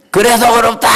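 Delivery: high, ringing cry of righteousness; proud declaration. Shown as a demonstration. Preacher showing the congregation how to answer, proudly. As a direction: shout it with your chest out. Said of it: "그때는 더 어깨 힘 주세요".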